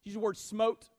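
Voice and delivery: usual speaking voice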